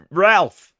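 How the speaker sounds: Ralph with a flat American a, said firmly; plain Yankee Ralph, like ralf. Shown as a demonstration. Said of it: "too American to pronounce a name like that".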